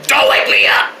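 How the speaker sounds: harsh whispered yell; whispered shout. Acted.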